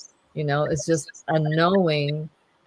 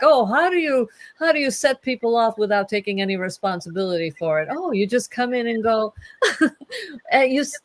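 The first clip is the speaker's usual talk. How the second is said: passive aggressive; 7 semitones higher than usual. Pretend. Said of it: "great passive aggressive".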